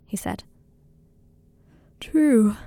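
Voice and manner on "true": drawn-out word into a yawn. A narrator's reading. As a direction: say it through a sleepy yawn. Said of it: "and yawned".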